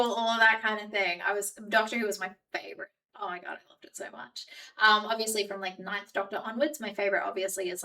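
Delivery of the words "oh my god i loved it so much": whispered gush over a favourite show; hushed, fast fangirl aside; breathy gush. Real, not acted. Whispering to chat about loving Doctor Who.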